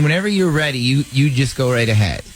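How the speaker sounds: ordinary talk, nothing marked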